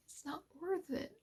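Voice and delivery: it's not worth it, dropped soft and quiet; soft, near-breaking. Real, not acted. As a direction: say it softly, almost to yourself. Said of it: "And I just wanted to say".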